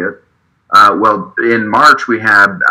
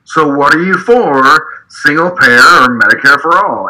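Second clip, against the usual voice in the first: yelled heckle; 5 semitones higher than usual. Retold or imitated. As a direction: call it out across the room. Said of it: "and I yelled out again".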